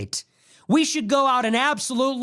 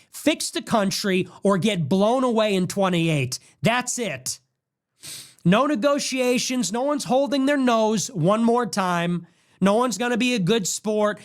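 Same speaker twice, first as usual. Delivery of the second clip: ordinary delivery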